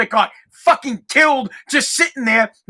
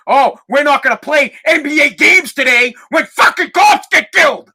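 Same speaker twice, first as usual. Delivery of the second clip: angry rant; 3 semitones higher, 4 dB louder than usual. Retold or imitated. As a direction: build to an incensed shout. Angry mock statement, shouting.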